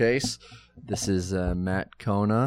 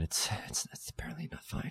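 hushed whisper, much softer; 16 dB quieter, faster than usual. Real, not acted. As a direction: whisper so nobody overhears. Andy is nearby, keeps it quiet.

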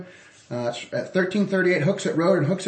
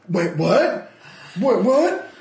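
startled; 8 semitones higher, 4 dB louder, much slower than usual. Retold or imitated. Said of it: "And I went".

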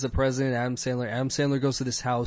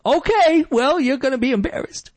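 smug threat; 12 semitones higher, 6 dB louder than usual. Retold or imitated. Mocking Sandler's threat to company.

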